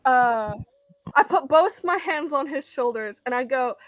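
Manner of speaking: normal talking voice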